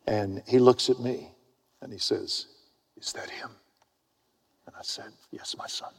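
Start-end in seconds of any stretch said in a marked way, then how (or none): 3.0-3.5 barely voiced, whispered asking; soft, reverent whisper; awed hushed question. Voices awed kid asking him. 5.3-5.9 whispered fatherly reply; soft, reverent whisper; mock-solemn hush. Mock-solemn answer to the kid.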